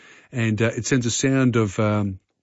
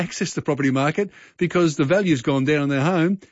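normal talking voice

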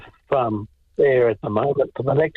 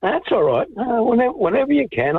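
cheerful and easygoing, pitched high; chipper; 11 semitones higher than usual. Real, not acted. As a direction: sound chipper, lifting the voice well up. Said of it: "it's nice to hear you sounding chipper".

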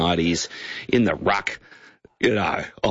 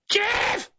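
high-pitched cockatoo squawk of a name; cockatoo yell. Retold or imitated. Radio guest imitating a farm cockatoo calling a child, squawked very high. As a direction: screech it like a pet cockatoo. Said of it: "it would yell out".

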